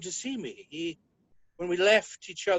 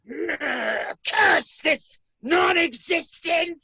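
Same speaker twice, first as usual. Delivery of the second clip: high, loud, drawn-out curse; anguished yelling; 8 semitones higher, 5 dB louder, much slower than usual. Acted. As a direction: howl your curse at the void. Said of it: "Stop yelling at me".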